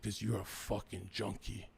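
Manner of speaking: low, quiet, slow brother's blunt verdict; quietly imitating the brother calling him a junkie. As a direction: drop the voice low and quiet, slowly. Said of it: "And he said".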